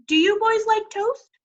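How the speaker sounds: high, cheery offer; mother the firemen; sweet old lady. A narrator's reading. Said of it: "wife offering breakfast".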